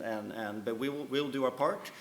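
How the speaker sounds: ordinary delivery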